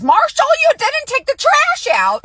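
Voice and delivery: high, drawn-out fussing holler; hollering wife. Retold or imitated. Wife fussing at husband over trash, mock holler. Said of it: "she comes out of the house hollering".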